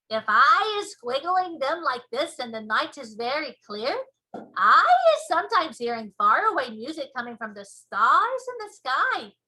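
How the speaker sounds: loud boastful giant; booming giant voice. Acted. BFG wonder.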